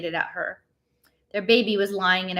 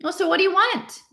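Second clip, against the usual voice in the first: high, pushy demand; a grumpy husband demanding her business; 12 semitones higher, 4 dB louder than usual. A narrator's reading. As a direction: throw the question at her. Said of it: "Nino demanded".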